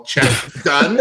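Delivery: normal talking voice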